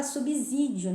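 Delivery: "subsídio" with a z sound, "subizídio"; wrong pronunciation. Shown as a demonstration. Teacher showing students the common error, s as z. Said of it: "tem muita gente que fala subsídio".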